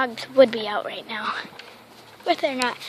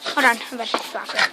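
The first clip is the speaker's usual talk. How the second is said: ordinary delivery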